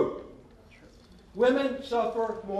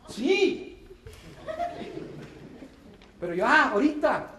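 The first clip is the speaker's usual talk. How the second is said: quick, eager and louder, raised pitch; eager yes; 6 semitones higher, 4 dB louder than usual. Retold or imitated. Eager groom accepting the proposal, louder and quick. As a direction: blurt it out, can't wait.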